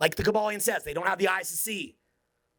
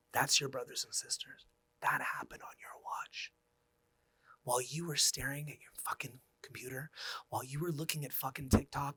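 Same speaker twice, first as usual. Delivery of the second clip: whisper; 2 semitones lower, 9 dB quieter than usual. Real, not acted. Solemn rebuke to his audience.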